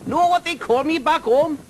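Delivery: high, loud call from the sky. Acted. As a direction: call down to friends far below. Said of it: "he called down to them".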